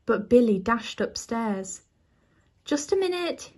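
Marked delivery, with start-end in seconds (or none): 2.6-3.5 calling out. A narrator's reading. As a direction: lightly call back in a higher voice. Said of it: "he called".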